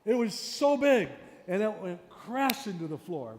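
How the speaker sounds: high, loud and slow for drama; big loud telling. Shown as a demonstration. Teacher acts out a crash for the class. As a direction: blow it up big. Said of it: "if something's loud".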